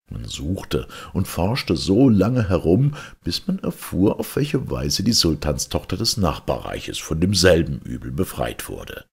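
usual speaking voice

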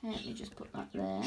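hushed whisper under the breath; whispered aside. Real, not acted. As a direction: mutter to yourself while working. Working quietly near spider.

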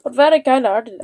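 lightly put-on accent slipping from Irish to Scottish; muddled Irish-Scottish lilt; wandering Irish-Scottish accent. Villager telling Link who cared for him, muddled Irish-Scottish accent. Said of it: "what happened to my accent there".

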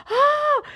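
high, loud squeal; retold excited scream. Driver hearing it is really happening, high loud squeal. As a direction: reenact a burst of overwhelmed joy. Overjoyed reaction while driving.

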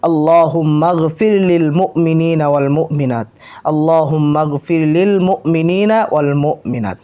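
slow, measured teaching recitation; steady devotional chant for learners to follow; Arabic prayer recitation. Shown as a demonstration. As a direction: recite it slowly with long vowels. Repeats short prayer for learners.

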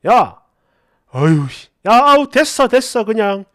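acted exasperated brush-off, punched loud at first; irritated boyfriend giving up on the talk; huffy irritation. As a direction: cut the argument off in a huff. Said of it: "그날 발끈하면서 화를 내더니".

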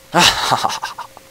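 high, loud burst of laughter. Real, not acted. Player gloating over a kill, loud laugh. Cackles while killing a game creature.

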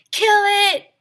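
very high, very loud yell. Acted. The boys yelling to chase the squirrel, very high and very loud. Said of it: "yelled the boys".

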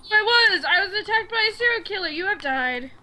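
high, fast, exclaimed complaint; indignant outburst. Real, not acted. Player attacked just after remembering, indignant. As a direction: blurt it out in a rush.

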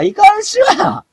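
high falsetto scold, hammed up; mock scolding. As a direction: scold in a shrill put-on voice. Scolding himself as お前. (裏声でふざけて叱る)

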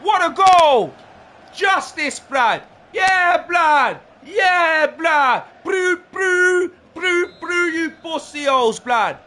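elated, punchy victory shouts; triumphant. Real, not acted. Still celebrating the goal.

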